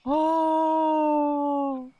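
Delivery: long high wail; loud squeal. Real, not acted. Reader overcome by the romantic scene, long loud squeal.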